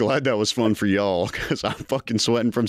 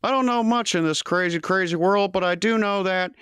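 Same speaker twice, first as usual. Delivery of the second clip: put-on higher, folksy old politician; lightly voiced Biden, pitched up; Joe Biden; 8 semitones higher than usual. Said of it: "Joe Biden".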